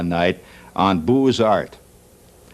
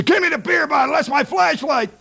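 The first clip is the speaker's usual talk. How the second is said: high, insistent holler; husband's comic yell. Acted. Husband demanding the bottle flashlight from his wife, high comic yell. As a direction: yell back like an impatient husband. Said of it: "He yells into his wife".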